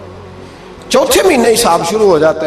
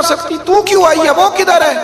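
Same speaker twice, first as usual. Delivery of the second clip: upset father; 13 semitones higher than usual. Retold or imitated. Father demanding where daughter is.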